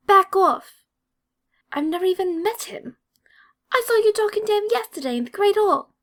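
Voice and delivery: angry shout. A narrator's reading. Angry redhead shouts at Draco in the hallway, loud and angry. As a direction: yell it in his face. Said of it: "The angry Ginger".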